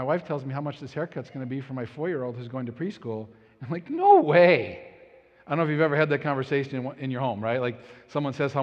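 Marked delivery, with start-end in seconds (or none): 3.9-4.8 scoffing no way; mock incredulous; flat refusal. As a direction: brush off the price, loud. Dad refusing haircut price.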